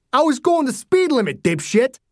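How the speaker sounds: high, indignant yell in self-defence; angry retort. A narrator's reading. Driver to the angry father, indignant. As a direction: fire back with an insult.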